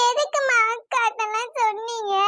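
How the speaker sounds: whining through tears; tearful. Acted. Upset daughter protests to her mother about telling her sister, tearful. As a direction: complain to mum while crying. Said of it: "நீ இப்படி அழுதுகிட்டே இருந்தா".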